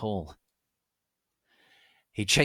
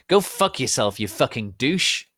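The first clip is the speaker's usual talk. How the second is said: loud, raised cursing; angry shout; 5 semitones higher, 7 dB louder than usual. A narrator's reading. Lucian shouting at James who tried to shoot him, angry yell. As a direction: spit the insult at him. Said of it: "he shouted at him".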